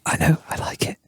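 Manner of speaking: whispered aside out of the scene; real whisper. Real, not acted. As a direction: drop to a whisper to break character.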